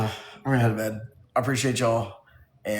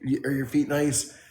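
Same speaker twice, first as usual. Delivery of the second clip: ordinary talk, nothing marked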